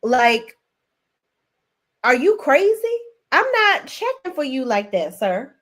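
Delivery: imitating an incredulous pastor; [name] quoting. Retelling the pastor's reply to Myron, mock exasperated. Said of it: "So he's like".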